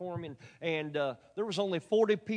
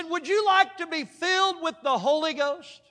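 higher, louder, bright invitation rising at the end; eager preacher question; 9 semitones higher, 8 dB louder than usual. Retold or imitated. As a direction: lean in and ask it eagerly. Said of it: "I said".

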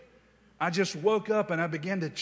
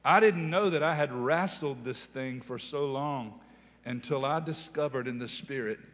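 ordinary talk

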